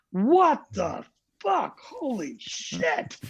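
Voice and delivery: slow, awed swearing as if floored; stunned disbelief. Retold or imitated. Crew stunned by loud chorus.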